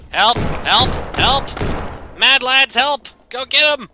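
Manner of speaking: high, loud shouts for help; calling for help. Acted. Calling his companions for help, loud shouts. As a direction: yell to the companions to save him.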